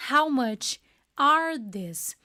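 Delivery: "How much are these?" stretched very slowly with a falling end; very slow model. Shown as a demonstration. Teacher modelling the plural price question, very slow. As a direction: spell it out word by word. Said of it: "aí eu perguntaria".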